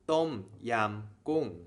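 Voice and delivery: slow "tom yam goong" with falling tones; with Thai tones. Shown as a demonstration. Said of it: "putting that all together".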